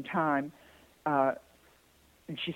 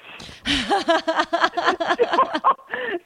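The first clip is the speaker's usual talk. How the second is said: high, bursting laugh; real high laugh; big laugh; 3 dB louder than usual. Real, not acted. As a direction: laugh out at the punchline. Laughing at own story.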